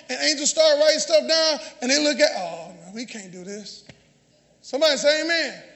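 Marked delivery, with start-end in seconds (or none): 2.3-3.8 much softer, lower murmur of dismay; hushed dismay. Acted. Angels reading what believers said, hushed dismay. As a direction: voice the letdown low and quiet.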